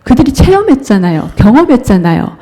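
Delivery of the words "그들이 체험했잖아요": usual speaking voice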